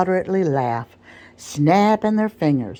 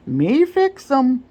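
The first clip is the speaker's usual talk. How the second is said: high, slow, gleeful little boast; approving grin; 10 semitones higher, 4 dB louder, slower than usual. Acted. Sam boasting to himself about the colt, high and sly. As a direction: grin through it, pleased with the trick. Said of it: "rolling his eyes with approving grin".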